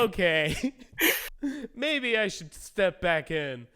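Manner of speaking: lightly amused, laughing mid-line; laughing while quoting. Retold or imitated. Player laughing at the war, deciding to step back in. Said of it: "And I'm like".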